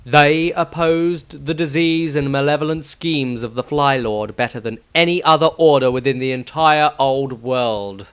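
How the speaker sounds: plain reading voice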